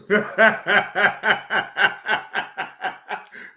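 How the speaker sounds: long high-pitched laugh; high laughter. Real, not acted. Streamer cracking up at his ban story, high laugh.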